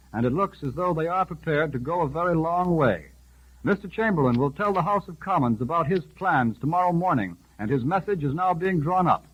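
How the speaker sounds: ordinary delivery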